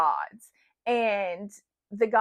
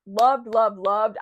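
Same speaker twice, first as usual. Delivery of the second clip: each loved stressed and a bit louder; gushing triple loved; emphasized repetition; 5 dB louder, slower than usual. Shown as a demonstration. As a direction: lean hard on every loved. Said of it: "how much I'm emphasizing".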